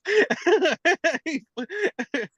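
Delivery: high helpless burst of laughter; big real laugh. Real, not acted. Telling a friend the story, laughing hard.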